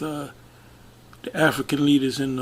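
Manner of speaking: ordinary delivery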